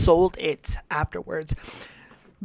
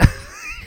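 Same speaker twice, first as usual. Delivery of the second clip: high giggle burst. Real, not acted.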